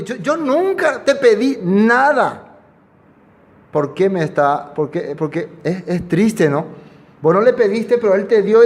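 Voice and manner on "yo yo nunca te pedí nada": higher, slow reproachful mother; a lightly voiced hurt mother, slowing down; mother reproaching the prophet, slow and higher; grieving mother.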